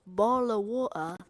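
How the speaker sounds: glottal British "Bottle of water", bo'le; British accent. Shown as a demonstration. Teaching the AI a British "Bottle of water". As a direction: drop the t in "Bottle" British style. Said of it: "You're supposed to say it like this".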